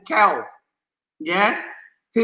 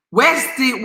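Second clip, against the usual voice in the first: usual speaking voice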